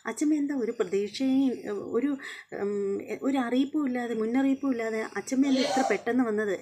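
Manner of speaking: light up at the visit; happy surprise. Retold or imitated. Revathi greets her grandmother, a touch of delight.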